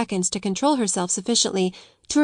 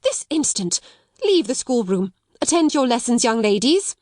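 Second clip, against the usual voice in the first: breathless orders; 4 semitones higher than usual. Acted. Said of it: "cried Miss Minchin breathlessly".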